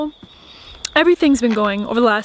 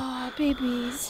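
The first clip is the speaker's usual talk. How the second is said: soft slow cooing; 11 dB quieter, much slower than usual. Real, not acted. Cooing at the cows.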